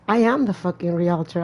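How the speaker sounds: ordinary talk, nothing marked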